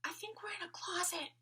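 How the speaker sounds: high tiny whisper; a small doll whispering from hiding. A narrator's reading. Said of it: "another voice added".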